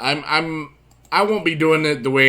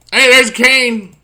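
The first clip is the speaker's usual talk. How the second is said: excited shout; 10 semitones higher, 9 dB louder, slower than usual. Real, not acted. Spots Kane in game.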